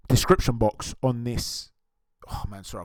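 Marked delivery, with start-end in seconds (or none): 2.3-2.8 strained by a back spasm; talking through sudden back pain; having a back spasm. Real, not acted. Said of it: "I've got a back spasm".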